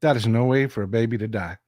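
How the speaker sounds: low, subdued sorrow with long pauses; sombre grief. Real, not acted. Grieving babies drowned last night.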